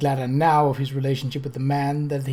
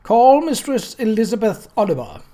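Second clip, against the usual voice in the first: a higher, louder clerk's voice cutting over the crowd; tinny raised voice; 7 semitones higher, 7 dB louder than usual. A narrator's reading. Clerk calling the witness over the crowd's stir, tinny and loud. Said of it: "raised his tinny voice over the stir".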